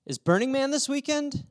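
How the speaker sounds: put-on high-pitched girl's question, rising; mimicking a girl, pitched well up; imitated girl. Girl's question acted again for camera.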